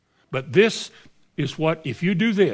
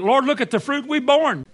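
high, pleading boast; crowing about their fruit, pitched up; boastful plea; 9 semitones higher than usual. Acted. Boastful claimants quoted.

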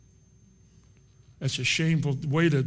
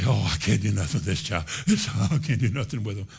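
a hint of a lower, defeated parent voice; helpless parent sighing about the child, lightly voiced; 8 semitones lower than usual. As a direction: mimic a parent giving up. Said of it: "Should you just say".

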